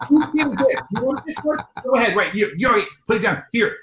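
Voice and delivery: high, rushed calling out to parents; frantic mask handoff, pitched high; mock harried dad. Parent handing back masked kids.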